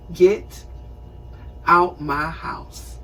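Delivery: slow, soft, steely order; firm dismissal. Retold or imitated. Grown daughter ordering her mother out, slow quiet firmness. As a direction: say it low and deliberate, no shouting.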